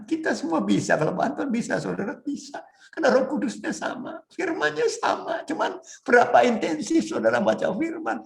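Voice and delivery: high-pitched, urgent sermon exhortation; fervent preaching. Real, not acted. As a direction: preach it up high, pressing the congregation. Preaches an exhortation at full force. (khotbah berapi-api bernada tinggi)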